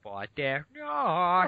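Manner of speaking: drawn-out, high goofy echo; silly echo. Pretend. Co-host echoing the other host's line, silly drawn-out mock voice.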